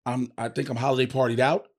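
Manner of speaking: ordinary talk, nothing marked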